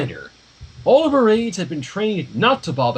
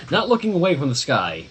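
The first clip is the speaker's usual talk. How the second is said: usual speaking voice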